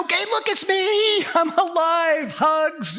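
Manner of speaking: high, bright, overjoyed; excited greeting. Retold or imitated. Rising to his grieving friends with joy. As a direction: burst out with glad news. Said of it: "wouldn't you go".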